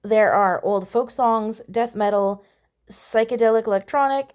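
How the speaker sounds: usual speaking voice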